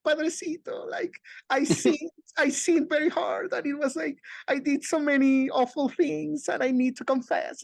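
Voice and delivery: high-pitched mock-tearful confession; Chabelita crying. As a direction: imitate a pious woman wailing to her priest. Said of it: "seriously crying and going".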